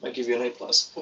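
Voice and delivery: jokey line ending in a pretend choke; hammed-up choking on a drink after the line; comic mock choke. Shown as a demonstration. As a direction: say it, then fake choking on a drink. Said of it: "winked and then choked on my drink".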